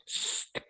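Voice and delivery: "st-" as voiceless air through closed teeth; hissed st onset. Shown as a demonstration. Teacher isolating the first sound for students, unvoiced "st-". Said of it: "Juntamos nuestras dentaduras y botamos el aire".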